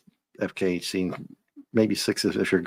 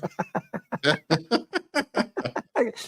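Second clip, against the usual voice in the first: long high giggle; high laugh; 14 semitones higher than usual. Real, not acted. Host cracking up over the leather line, high laugh.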